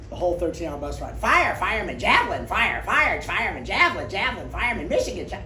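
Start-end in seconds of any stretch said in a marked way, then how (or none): 1.2-5.4 high, loud sing-song babble of words; endless chatter mimicked. Teammate chattering whole bus ride.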